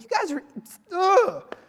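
high mock-frustrated complaint; fed up with Adam and Eve. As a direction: blame them for ruining it all. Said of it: "I'm like".

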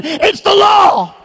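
high, slow, shouted "It's the law"; shouted climax. Acted. Climactic repeat of the refrain.

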